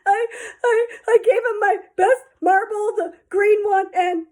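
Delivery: high, loud, stammering through tears; a sobbing child confessing; crying. Acted.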